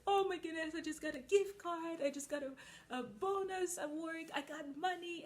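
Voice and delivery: high squealing mock delight over money; mocking people thrilled to get money; mock excitement. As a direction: gush like you just got paid. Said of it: "It's like".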